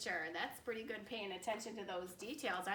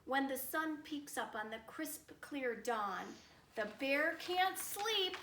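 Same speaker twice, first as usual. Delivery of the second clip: rhyming story narration; 5 dB louder than usual. A narrator's reading. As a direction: lift the rhyme toward the bear's lair.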